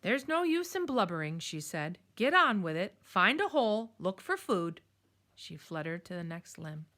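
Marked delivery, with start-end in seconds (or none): 0.0-1.4 loud, higher scolding owl; bossy owl barking orders; stern owl. Acted. 2.2-4.8 bossy owl barking orders; stern owl. Acted.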